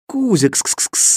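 high, slow calling out; calling the cat. A narrator's reading. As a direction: coax the cat to come. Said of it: "звал я кота".

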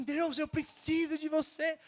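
high, drawn-out plea to God; retold crying out. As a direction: re-enact a desperate shouted prayer. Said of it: "eu gritava, meu irmão, eu falava assim".

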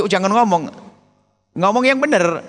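normal talking voice

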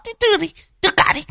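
very high, rapid-fire squeak; rushed demand. Acted. Old Đồng snatching the money from Phọt, very high and rapid. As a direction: rattle it off impatiently.

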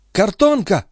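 high-pitched excited call; sudden high exclamation; excited cry. A narrator's reading. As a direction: cry out the news. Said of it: "крикнула она".

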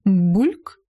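low, then sharply rising 'bulk'; ominous bubbling potion plop, swooping upward; угрожающий бульк. A narrator's reading. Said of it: "угрожающе сказала Варева". (угрожающее бульканье зелья)

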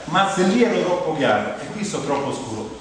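ordinary talk, nothing marked

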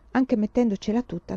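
plain reading voice

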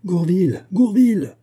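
high, rising-intensity shout of a name; frantic summons; crying out. A narrator's reading. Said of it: "cria-t-il".

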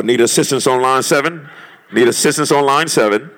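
mock intercom page; store intercom call. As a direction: page it like over the store speaker.

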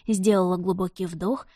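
usual speaking voice

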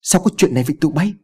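high, quick cry of alarm. A narrator's reading. Boss finding corpses instead of captives, crying out in alarm. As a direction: blurt it out in shock, pitched well up. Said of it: "và kêu lên thất thanh".

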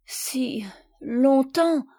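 drawn-out whispered repeat, very slow. Acted. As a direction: let the words fade into a slow whisper. Sophie se souvient, émue. (chuchoté, très lentement)

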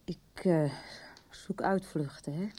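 ashamed whisper. Acted. Admitting she makes excuses.

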